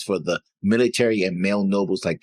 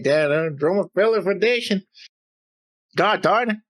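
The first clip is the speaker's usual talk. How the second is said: put-on hammy Southern accent, higher pitch; 9 semitones higher than usual. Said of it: "there goes my Southern accent".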